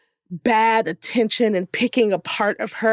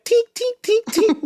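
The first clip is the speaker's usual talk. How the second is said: high, squeaky phone-shutter tinks; imitating camera clicks; 13 semitones higher than usual. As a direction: mimic tapping the shutter. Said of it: "was like".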